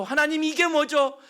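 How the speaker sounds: loud, high demanding protest; complaining to God. Acted. Churchgoers demanding a sign from God, loud complaint. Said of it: "원망도 해보죠".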